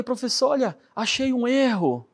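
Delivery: bem mais agudo, chamando o professor; aluno empolgado. Retold or imitated. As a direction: reencenando a descoberta de um erro. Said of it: "E eu falei". (aluno animado, em tom agudo)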